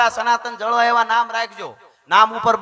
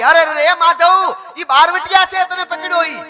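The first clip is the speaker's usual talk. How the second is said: high, loud, panicked pleading of a mother; mother's scream; 9 semitones higher, 4 dB louder than usual. Retold or imitated. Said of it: "માની રાડ ફાટી ગઈ".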